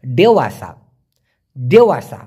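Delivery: দেওয়াসা said twice, each falling, with a pause between; pronunciation model. Shown as a demonstration. Teacher has learners repeat দেওয়াসা, firm model pronunciation. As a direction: say দেওয়াসা firmly as a word to repeat. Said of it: "আমার সাথে please উচ্চারণ করবেন".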